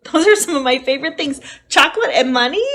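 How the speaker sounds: excited gush. Real, not acted. Teacher gushing to kids about chocolate and money. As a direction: burst out with delight.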